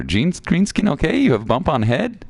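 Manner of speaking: high-pitched critter asking; concerned little creature. Acted. Voicing game creature's dialogue.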